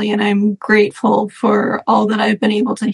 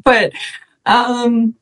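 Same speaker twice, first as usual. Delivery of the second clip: usual speaking voice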